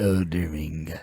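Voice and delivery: low, dramatic title drop; deep announcer voice. Pretend. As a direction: intone it like a trailer voice. Mock deep announcer intoning title.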